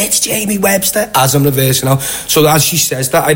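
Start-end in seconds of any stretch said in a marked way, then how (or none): 0.0-1.1 high-pitched mock-female reply; imitated woman fan. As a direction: mimic one of the women, voice pitched up. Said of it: "And one of the".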